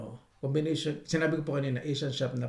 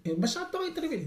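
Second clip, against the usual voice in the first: mock a snooty remark, pitched much higher; high, mocking "Eh, masyadong intimidated"; mocking quote. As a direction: sneer "masyadong intimidated" like a snob would. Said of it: "lalakasan yung boses mo na sabihin".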